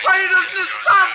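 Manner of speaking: breaking into hysteria; hysterical scream. Acted. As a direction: scream it at the top of your lungs. Tormented by the voice.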